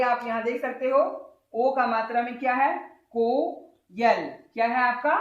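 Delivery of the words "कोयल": कोयल split slowly into को, यल; slow sounded-out कोयल; syllable by syllable. Shown as a demonstration. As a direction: stretch को, pause, then यल. Teacher sounds out vowel sign.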